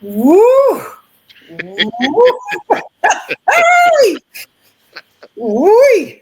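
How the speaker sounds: loud high whoops with laughter; excited cheering. Real, not acted. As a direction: cheer wildly, can't hold it in. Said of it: "let me compose myself".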